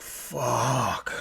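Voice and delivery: low, quiet, drawn-out curse; understated acted dismay. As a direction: let it sink out softly in dismay. Orion's dismayed reaction in story.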